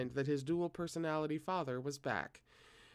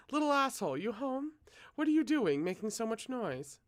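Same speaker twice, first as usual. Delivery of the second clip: mother calling out; 8 semitones higher than usual. A narrator's reading. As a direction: call out sharply like a nagging mom. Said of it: "his mother's voice ... called out to him".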